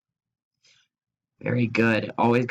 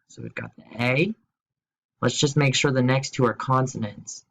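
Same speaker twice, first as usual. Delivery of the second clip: ordinary talk, nothing marked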